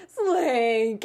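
a mock-anguished cry for the dog, lightly voiced; Woody calling Slinky. Shown as a demonstration. Woody caught in the window, crying out Slinky. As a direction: call out Slinky in dismay, half-hearted Woody. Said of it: "I can do a good Woody when Slinky closes".

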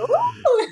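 high-pitched playful "woo!"; swooning woo. Acted. Guest swooning over fast Spanish talkers, giddy woo.

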